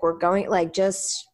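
ordinary delivery

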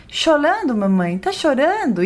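put-on high, swooping little-child questions; baby-talk child voice with leaping pitch; imitating a small child. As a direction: ask like a concerned toddler. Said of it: "ela fala assim".

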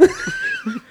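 shrieking laugh. Real, not acted.

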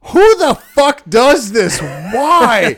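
high, loud disbelieving cry; incredulous outburst. Real, not acted. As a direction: shriek it in disbelief. Incredulous outburst at odd contest.

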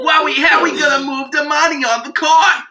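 high, hammed-up Long Island shout; mock cake boss. Friends riff on moving money, mock flustered shout.

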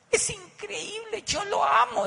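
high, near-tearful gush of adoration; a woman gushing over her beloved boss; gushing about the boss. Retold or imitated. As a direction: rave about him like he hung the moon. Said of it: "se le iluminaba la cara cuando hablaba del jefe".

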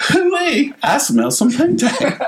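put-on very high, innocent kid whoop; imitated fifth grader. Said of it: "I just look at my parents and I go".

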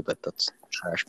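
quick dismissive whisper, slow; whispered aside. Real, not acted. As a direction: breathe it out as a scornful aside.

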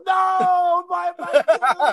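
high, loud shout of "No, my man"; big hammed-up shout; mock shout. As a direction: yell it at your mate in jest. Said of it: "I go".

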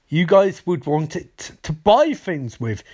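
normal talking voice

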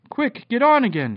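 high, loud urgent shout; urgent call. A narrator's reading. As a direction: call everyone aboard in a hurry. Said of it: "he called".

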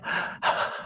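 soft swooning exhale; dreamy sigh. Acted. The apprentice swooning at Gordon, soft sigh. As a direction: melt into a long sigh. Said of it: "And you're like".

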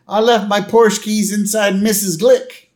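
high-pitched confessional outburst; acted doctor owning up in a high voice; Doctor Hibbert. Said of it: "then Doctor Hibbert goes".

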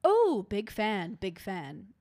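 mock British accent, big drawn-out opening. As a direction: put on a slow British star's accent. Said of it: "in his British accent".

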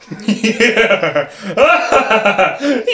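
long gloating creature cackle; drawn-out monster laugh; villainous laugh. Acted. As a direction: laugh in triumph over fallen prey. Creature laughs over exhausted victims.

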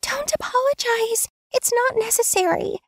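high female voice cutting in firmly; interrupting. A narrator's reading. Tansu interrupting Kim's apology, high and firm. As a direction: cut him off gently but firmly. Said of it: "She interrupted me".

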